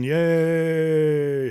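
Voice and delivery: high, loud burst of "yay"; excited cheer. Real, not acted. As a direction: cheer like a game-show host at a right answer. Celebrating a viewer's right answer.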